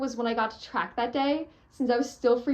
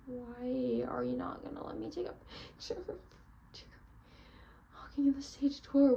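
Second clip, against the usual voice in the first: hushed, whispered whine; whispered complaint; 2 semitones higher, 7 dB quieter than usual. Retold or imitated. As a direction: grumble it under your breath so dad can't hear. Complaining to dad quietly.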